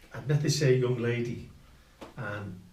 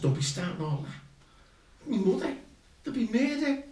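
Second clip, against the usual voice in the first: retold anxious protest; 12 semitones higher than usual. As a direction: pitch climbing in mock alarm. Protests to wife in retold argument.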